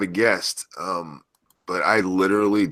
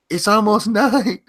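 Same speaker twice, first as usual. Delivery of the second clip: imitating wife, laughing; 13 semitones higher, 5 dB louder than usual. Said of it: "She goes".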